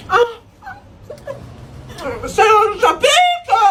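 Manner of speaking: sudden high shout as the heat hits; real shout from spice. Real, not acted. Vlogger reacting to the spiciest McDo sauce, shouting. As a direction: yell it, mouth on fire.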